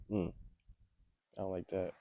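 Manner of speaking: ordinary talk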